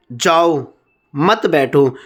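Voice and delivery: जाओ set apart, short break, then मत बैठो together; pause after जाओ. Shown as a demonstration. Teacher reads the example sentence, pausing after जाओ before मत बैठो. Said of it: "मैंने जाओ के बाद कोमा लगा रखा है".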